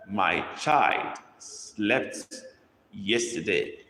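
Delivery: put-on My child slept said word by word, very slow; slow dictation. Shown as a demonstration. Teacher dictating to the class, very slow and clear. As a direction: spell it out slowly for the class to copy. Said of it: "dictating a sentence to copy".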